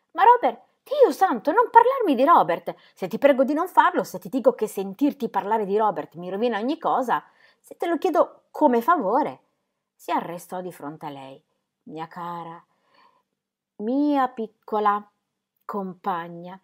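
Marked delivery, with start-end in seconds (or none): 0.1-9.4 high, loud urgent appeal; exasperated pleading. Acted. Ingram begging Ingeborg not to mention Robert, exasperated. 11.8-16.6 tender. Acted. Tender address to Ingeborg.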